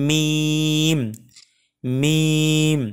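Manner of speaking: "মিম" with a long, level ee and firmly closed lips; firm drawn-out pronunciation. Shown as a demonstration. Teacher showing the letter to learners, "মিম" firmly held long. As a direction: stretch "মিম" slowly on a steady note. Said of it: "একটু শক্ত করে উচ্চারণ করতে হয়".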